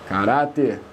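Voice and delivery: caráter stressed on RÁ, falling low; correct word stress. Shown as a demonstration. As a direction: say "caráter" with weight on the middle syllable. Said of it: "Esse que era o correto".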